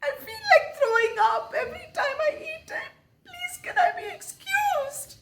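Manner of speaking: very high, crying squeak; squeaky tearful plea. Retold or imitated. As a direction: squeak it out through tears. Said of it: "I managed to squeak out".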